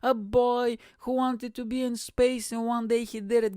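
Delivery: sappy put-on voice, gushing about space; mocking Branson. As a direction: lift it up and drawl it out like a starry-eyed kid. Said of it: "He's like".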